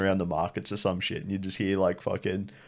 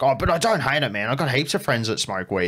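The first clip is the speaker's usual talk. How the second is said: 1 defensive self quote; 5 semitones higher than usual. Retold or imitated.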